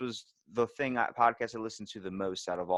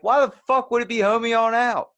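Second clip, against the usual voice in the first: much higher, much louder outraged shout; exasperated yell; 13 semitones higher, 12 dB louder than usual. Retold or imitated. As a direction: blast it like you can't believe them. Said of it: "I wanna scream at".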